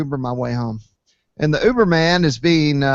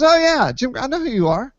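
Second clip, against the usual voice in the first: much higher, eager and delighted; excited recognition; 10 semitones higher than usual. Retold or imitated. Uber driver recognizing the site admin, excited and high. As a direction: sound starstruck, pitched way up.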